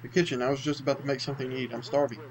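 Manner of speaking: normal talking voice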